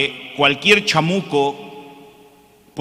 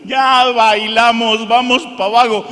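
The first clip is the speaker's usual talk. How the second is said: panicked shout; 8 semitones higher, 9 dB louder than usual. Retold or imitated. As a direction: cry out as the plane drops. Panicking passenger on falling plane.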